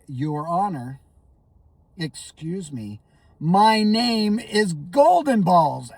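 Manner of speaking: deliberate, slow insistence on Golden Balls; slow insistent correction. Retold or imitated. Lawyer insisting on his new name to the angry judge, slowly. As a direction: spell out the name as if to a child. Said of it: "I'm like".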